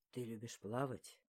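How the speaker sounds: low, soft man's question; male lead. A narrator's reading. He asks her whether she swims, low soft male voice. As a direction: ask it gently in a low voice.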